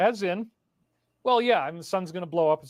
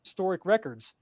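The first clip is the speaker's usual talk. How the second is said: usual speaking voice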